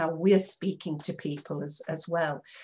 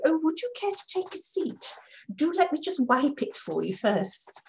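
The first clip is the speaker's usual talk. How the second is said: much higher, fawning and oily; smarmy; 7 semitones higher than usual. Shown as a demonstration. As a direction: grovel to impress the guest. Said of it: "Really sort of smarmy, toady".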